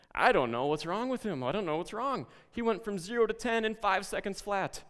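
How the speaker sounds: high, loud baffled complaint; exasperated teacher. Retold or imitated. Teacher complaining about Josh to the principal, high exasperated. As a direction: throw up your hands at the kid, high and loud. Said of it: "and the teacher says".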